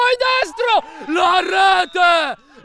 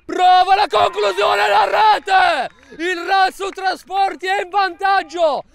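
breathless italian goal commentary; 7 semitones higher than usual. Real, not acted. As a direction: shout the goal from the top of your voice. Goal scored.

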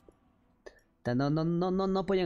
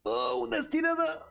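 shocked game reaction; slower than usual. Real, not acted. Streamer yelling at dramatic moment in match, shocked cries. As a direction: yelp at the play.

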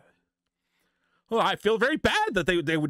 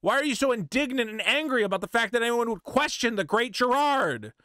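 ordinary delivery

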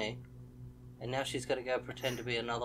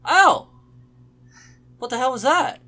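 high, loud shocked outburst; yelp, then demand what hit you; startled yell; 11 semitones higher, 16 dB louder than usual. Real, not acted. Player hit by something unseen in game, startled yell.

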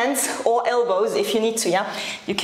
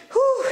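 whew; 12 semitones higher than usual. Real, not acted. Trainer puffing out a high whew before the rest. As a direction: blow out a high puff of relief. Exhale during squats.

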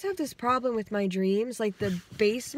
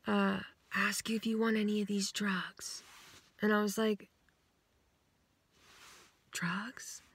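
hushed whisper; 7 dB quieter than usual. Retold or imitated. Whispering about the drugs on the porch. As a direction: say it under your breath. Whispered drug offer exchange.

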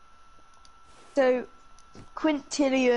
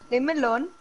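put-on Indian accent, broken English; mock Indian accent; faster than usual. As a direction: play-act an Indian voice. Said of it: "my Indian voice".

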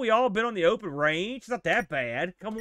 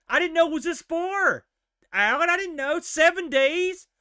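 pleading protest; 11 semitones higher, 4 dB louder than usual. Real, not acted. Pleading with game character.

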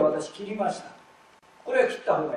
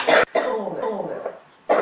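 そうです louder, then slow; emphatic demo; 6 dB louder, slower than usual. Shown as a demonstration. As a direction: stress そうです with a laugh. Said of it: "らに黒丸".

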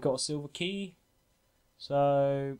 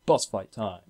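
normal talking voice

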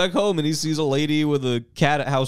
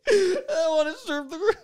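much higher, breaking into laughter; Jackie Vernon laughing; 13 semitones higher than usual. Retold or imitated. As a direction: repeat it cracking up. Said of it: "My favorite line of the movie".

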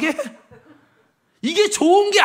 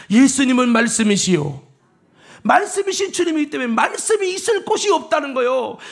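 normal talking voice

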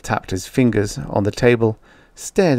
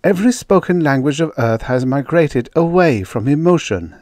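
higher-pitched, eager explaining voice; high, keen scientist making her case; earnest woman researcher; 6 semitones higher, 4 dB louder than usual. Acted. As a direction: put your case to sceptics.

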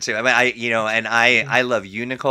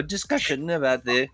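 usual speaking voice

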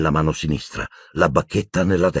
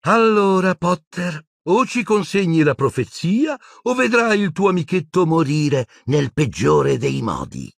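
high threatening voice, slowing at the end; high, wicked ultimatum; menacing villainess; 11 semitones higher than usual. Acted. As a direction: threaten with relish, drawing out the end. Bellatrix threatens Harry.

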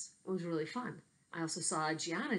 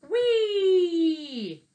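whee; 13 semitones higher, 12 dB louder than usual. Acted. Boy swings on vine.

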